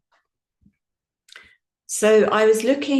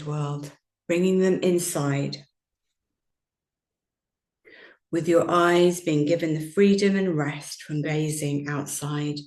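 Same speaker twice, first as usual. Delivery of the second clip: guided meditation. Acted. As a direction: ease the listeners gently into stillness. Guided yoga nidra meditation.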